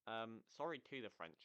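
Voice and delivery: ordinary delivery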